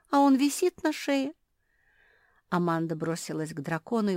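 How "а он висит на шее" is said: high, loud tearful complaint; crying. A narrator's reading. Жози sees the collar still hanging, crying. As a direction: whimper it like a child in tears. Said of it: "заплакала Жози".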